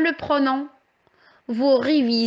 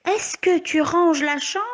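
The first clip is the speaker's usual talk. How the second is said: «Est-ce que tu ranges la chambre?» read with a clear question rise on «chambre»; asking a question; 5 semitones higher than usual. Shown as a demonstration. Teacher reading an example question aloud to the children, rising at the end. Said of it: "Cette phrase pose alors une question".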